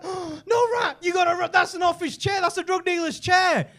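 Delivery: high-pitched mock panic; alarmed warning. Watcher warning the rat off a chair, high acted alarm.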